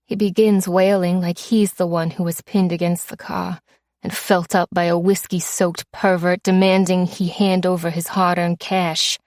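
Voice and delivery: ordinary delivery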